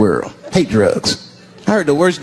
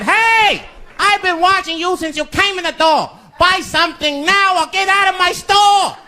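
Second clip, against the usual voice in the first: much higher, mock shopkeeper voice. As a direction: squeak out the grumpy store owner's warning. Voicing the shop owner.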